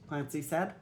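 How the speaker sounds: usual speaking voice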